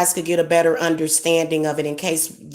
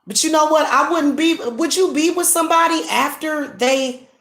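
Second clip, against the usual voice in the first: angry scolding; 8 semitones higher than usual. Real, not acted. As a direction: let him have it, voice pitched up. Scolding her ex Mike.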